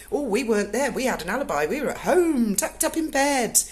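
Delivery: high, loud sing-song mimicry; mocking. Cat owner mimicking the plotters' alibi, mockingly. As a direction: parrot their excuses in a mocking swoop.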